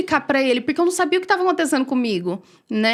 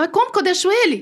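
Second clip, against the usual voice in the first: higher, pleading protest; retelling her protest to God, pitched higher; distressed plea; 7 semitones higher than usual. Retold or imitated. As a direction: push back in disbelief, voice up.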